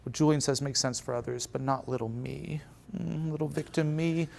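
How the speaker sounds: soft, self-pitying victim voice; mock grief. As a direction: play the helpless little victim. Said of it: "in grief".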